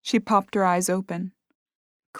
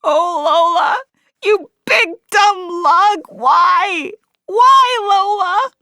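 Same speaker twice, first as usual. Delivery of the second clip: much higher, loud crying wail, slow; 13 semitones higher, 10 dB louder, slower than usual. A narrator's reading. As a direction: sob out her name in anguish. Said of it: "and she wailed".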